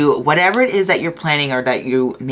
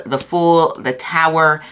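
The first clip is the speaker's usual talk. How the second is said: ordinary talk, nothing marked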